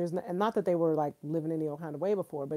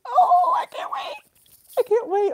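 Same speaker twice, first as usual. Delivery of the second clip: very high, louder, bubbling anticipation; can't-wait squeal; 9 dB louder than usual. Real, not acted. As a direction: bounce with impatience. Eager to watch the video.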